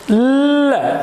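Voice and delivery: ल held, then released; sustained ल as a sound demo; demonstrated L sound. Shown as a demonstration. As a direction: hold the tongue on ल before letting go. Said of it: "ल बोलते हैं".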